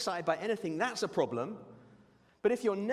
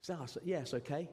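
softer, flat mock Judas shrug; unimpressed reply; 3 semitones lower, 5 dB quieter than usual. Preacher voicing Judas's lukewarm response, softer bored shrug. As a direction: mumble it like you couldn't care less. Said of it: "What kind of reaction is that?".

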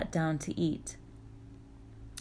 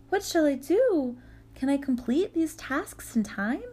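put-on young girl voice; 8 semitones higher than usual. Frightened girl Vasilisa.

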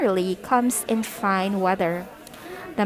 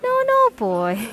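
chuckling; 3 dB louder than usual. A narrator's reading. Father sets his boy right about the helpers, chuckling. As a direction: correct the boy with an indulgent chuckle. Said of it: "chuckled Father".

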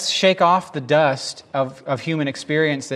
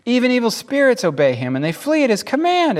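high, raised voice of amazement; exclaiming; 8 semitones higher, 3 dB louder than usual. Acted. Amazed crowd talks about Jesus casting out spirits, exclaiming. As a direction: marvel out loud, building higher. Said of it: "the people exclaim".

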